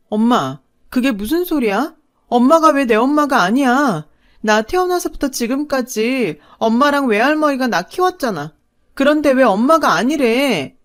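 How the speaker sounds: cling to her and beg through tears; crying plea. Acted. Daughter begs mother to deny it, crying plea. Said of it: "엉엉 울면서 엄마한테 매달렸어요".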